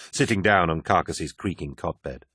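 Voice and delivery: ordinary delivery, nothing marked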